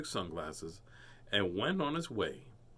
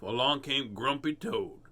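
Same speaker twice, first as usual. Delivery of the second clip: ordinary delivery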